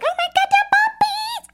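very high-pitched squeal at puppies; animal voice. Retold or imitated. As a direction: gush over animals in a tiny, squeaky voice. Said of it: "I do it in a voice".

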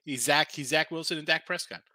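ordinary delivery

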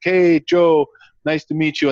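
put-on light Nigerian accent, friendly greeting; hint of a Nigerian accent. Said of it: "he had that awesome Nigerian accent".

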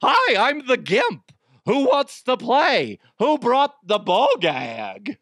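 high, loud, slow cartoon villain voice; goofy gimp voice. Gimp greeting his playmates in a high, slow cartoon voice. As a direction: squeal it out like a big dopey bad guy. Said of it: "I sound like that guy".

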